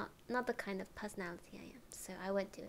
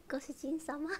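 lightly played, higher Japanese servant-style address; giggly character greeting; 6 semitones higher than usual. Streamer shows viewers their character, giggly and higher. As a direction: break into giggles while playing the character. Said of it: "my character is more like".

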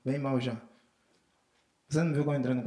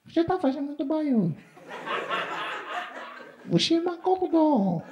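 put-on high child telling off a guest; a little boy scolding a grown-up; child's voice; 12 semitones higher than usual. A small boy telling a house guest off for making noise.

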